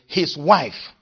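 "His wife" lifted and pressed; emphasis on "wife"; stress on wife. Shown as a demonstration. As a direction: punch "His wife" before easing off. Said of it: "because the emphasis is on the wife".

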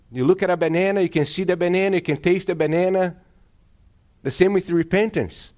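rally the congregation; full-force sermon with call for amen; preaching at full force. Acted.

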